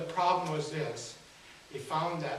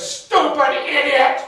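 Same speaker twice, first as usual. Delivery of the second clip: loud, high, drawn-out mock shout; Harold's indignant comeback; 7 semitones higher, 10 dB louder, slower than usual. Harold yells back at the woman driving away, hammed-up indignation. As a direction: holler it back slowly, acting outraged. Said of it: "he looks back at her as she's going down".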